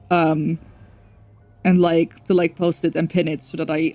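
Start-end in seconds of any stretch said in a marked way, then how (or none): none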